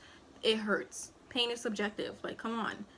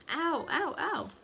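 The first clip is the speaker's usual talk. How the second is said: higher yelps of pain; pained ows; 7 semitones higher, slower than usual. Retold or imitated. Mother being stitched up cries out in pain. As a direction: wince out each ow.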